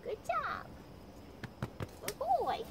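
quick, very high praise. Real, not acted. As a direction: chirp it up high for the dog. Praising the puppy.

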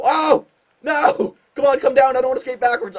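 high, loud cries of dismay; loud, frustrated gamer outburst; frustrated yelling. Real, not acted. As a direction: yell at the game as it goes wrong. Missed deke, player skates backwards.

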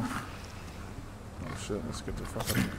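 very quiet, near-whispered retreat; under the breath, hushed escape; hushed alarm. Real, not acted. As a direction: whisper it low, wanting out fast. Startled in game, wants to flee.

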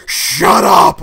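loud retold outburst; big, drawn-out mock yell; angry shout. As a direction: yell it like an irritated moviegoer silencing someone. Girl in cinema snaps at him.

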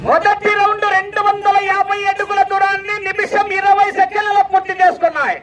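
high, level-pitched announcing call; race announcer. Real, not acted. Announcer calling bullock race round result to crowd, high chanting call. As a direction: ring out the round result on one high note.

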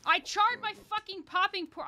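high, loud, drawn-out outburst; loud high-pitched gamer frustration; frustrated yell. Real, not acted. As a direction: yell it in exasperation, stretching the words. Burnt the food in game.

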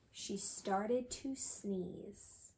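slow suspenseful whisper; hushed storytime whisper before the big moment. Acted. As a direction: whisper it slowly to build suspense.

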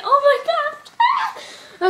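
high squeal of joy; real giddy outburst; happy and excited. Real, not acted. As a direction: climb into a laughing squeal. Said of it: "I'm so happy and excited".

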